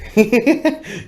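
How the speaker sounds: high mock cackle, loud; imitated laugh. Imitating Toker laughing as he offers the woman, a high loud cackle. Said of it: "and he was like".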